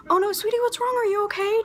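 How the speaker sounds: sweet worried mom. Retold or imitated. Mom answering her daughter's call, tender high concern. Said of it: "I have never heard a change of tone".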